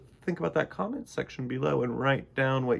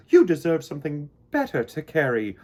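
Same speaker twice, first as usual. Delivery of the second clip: proud queen; 7 dB louder than usual. Acted. Queen bee praising Mister Bumble before the hive. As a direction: praise him like a delighted queen. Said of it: "she said".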